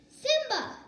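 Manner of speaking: high, loud, eager calling out; excited call. A narrator's reading. Nala spotting her friend Simba, excited high call. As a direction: call out brightly to a found friend. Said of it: "his best friend, Nala".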